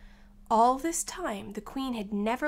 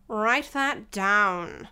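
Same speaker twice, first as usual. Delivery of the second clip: slow, loud royal order; lightly voiced King, drawn-out and loud; commanding King; 5 dB louder, much slower than usual. King giving an order in court.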